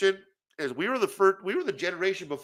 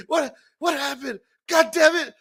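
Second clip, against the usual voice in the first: mock uncle; 9 semitones higher, 3 dB louder than usual. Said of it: "you can hear him in the room".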